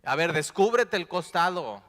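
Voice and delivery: bossing Jesus around, mocking; mock demanding Thomas. Preacher mocking Thomas demanding Jesus' wounds, loud. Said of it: "¿Te imaginas qué afrenta".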